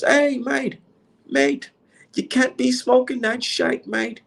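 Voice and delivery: high-pitched put-on British accent; mock British accent. British fan objects to him smoking, mock British accent. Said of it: "you can just hear that weirdo accent".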